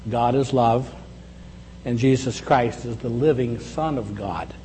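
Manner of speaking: ordinary talk, nothing marked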